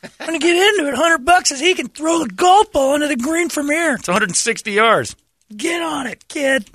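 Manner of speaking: hyped-up, high, loud coach shouting; hype man. Retold or imitated. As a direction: pump up the crowd like a hype man. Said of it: "like, hype man me".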